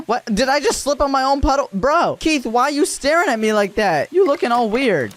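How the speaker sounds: high-pitched, incredulous demand; baffled outburst. Real, not acted. Gamer asks Keith why he's staring, high and baffled. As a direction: call him out in high disbelief.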